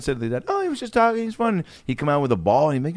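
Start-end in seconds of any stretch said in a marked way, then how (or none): 0.5-1.6 high, light pleading voice; smooth it over in a high voice; placating. Retold or imitated. Neighbor defending the kid to his dad, placating.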